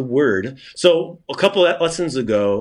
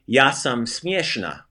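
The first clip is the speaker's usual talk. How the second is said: Ja sam smieshna slowly, syllable by syllable; slow careful Croatian; slower than usual. Shown as a demonstration. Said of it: "if you're a lady, you would say".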